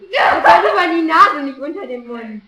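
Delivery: loud complaint. Real, not acted. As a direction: call out in pain. Makeup poked in face.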